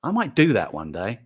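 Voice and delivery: normal talking voice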